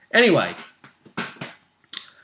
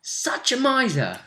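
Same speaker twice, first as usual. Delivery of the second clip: exasperated ribbing; 7 semitones higher than usual. Real, not acted. Ribbing a stingy player character.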